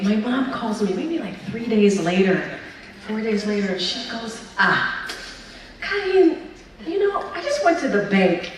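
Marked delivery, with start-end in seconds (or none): 4.6-8.5 put-on a light, higher-pitched go at a Haitian mom's accent; half-hearted impression of mom's Haitian accent, mostly raised pitch; mock Haitian mom accent. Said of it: "I can't do her accent at all".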